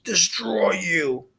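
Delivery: high, slow spluttering threat; spluttering Cyclops. Acted. Soaked Polyphemus splutters at Percy, high and slow. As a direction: choke the words out slowly. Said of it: "Polyphemus spluttered".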